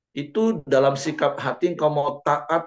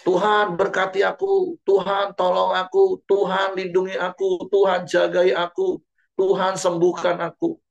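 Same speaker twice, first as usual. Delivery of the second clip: higher, pleading demands to God; mock a believer barking orders at God in prayer; hammed-up demanding prayer; 7 semitones higher than usual. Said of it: "memanggil dia Tuhan".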